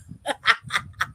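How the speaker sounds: a short real laugh at the punch. Real, not acted. Laughing after retelling the punch to the face. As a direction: laugh it off, amused.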